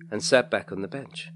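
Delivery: ordinary delivery, nothing marked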